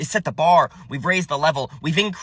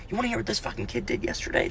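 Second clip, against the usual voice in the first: whisper; 8 semitones higher, 10 dB quieter, faster than usual. Real, not acted. Confides to audience.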